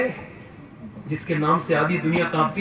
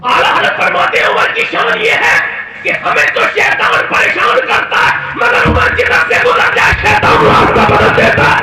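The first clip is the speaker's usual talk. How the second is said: high, loud impassioned sermon shout; preaching at full force; 8 semitones higher, 9 dB louder than usual. Acted. Preacher roaring to the congregation about Umar. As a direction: cry it out to the crowd.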